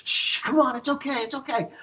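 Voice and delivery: put-on a touch of drunk pleading to calm her; mock soothing. Drunk husband calming his yelling wife, lightly mocked. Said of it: "and I'm like".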